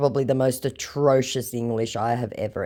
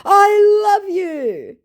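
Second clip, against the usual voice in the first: high, loud, drawn-out mock declaration of love; lovesick scammer; 9 dB louder, much slower than usual. Scammer declares love to her, hammed-up lovesick wail. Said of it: "he said".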